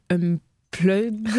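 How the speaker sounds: "Unplugged" stumbled over, final d lost; hesitant, mangled "Unplugged"; botched English word. Real, not acted. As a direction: fumble the end of "Unplugged" in a French mouth. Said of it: "Excusez-moi pour, euh, mon italien".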